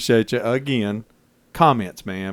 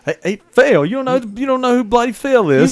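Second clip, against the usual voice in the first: higher, louder hammed-up British accent; English accent; 11 semitones higher, 5 dB louder than usual. Cohost showing off a loud mock British accent to the host. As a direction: belt it out like a mock cockney. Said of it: "Judge this accent, please".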